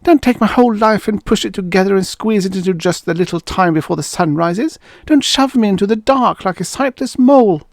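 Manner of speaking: high, fast frantic pleading; wife protesting desperately. Acted. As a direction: fight back in desperation.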